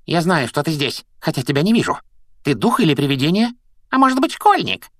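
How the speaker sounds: high-voiced, wicked poltergeist; malicious, high-pitched questioning; Peeves. A narrator's reading. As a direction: taunt an unseen intruder in a high voice. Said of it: "Его злобные чёрные глаза стали ещё злее".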